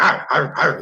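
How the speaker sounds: mock barks like a dog; imitated dog barking. Podcaster mimicking the fighter barking like a dog, mock barking. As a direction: bark it out in short bursts. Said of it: "Just barking like".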